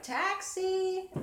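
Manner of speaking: high loud call with a laugh; hailing a taxi. Pretend. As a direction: call out like flagging a cab. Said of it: "I'm talking to my light".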